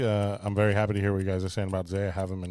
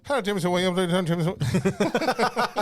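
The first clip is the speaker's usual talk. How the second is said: mock announcer call; 9 semitones higher, 5 dB louder than usual. Mock announcer call, high and loud.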